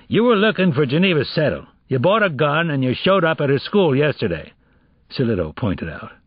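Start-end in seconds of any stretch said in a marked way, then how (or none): 0.1-4.5 higher-pitched, pressing accusation; insistent interrogator; detective confronting a suspect. Acted. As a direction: lay out the evidence against him.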